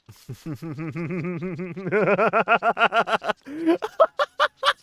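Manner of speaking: long loud giggling fit; long laugh. Real, not acted.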